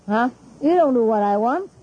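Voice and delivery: ordinary talk, nothing marked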